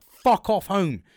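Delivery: loud, high, hammed-up exasperation; angry rant, retold. Volunteer cursing at people turning up, hammed-up angry rant. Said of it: "I was getting angry with people turning up".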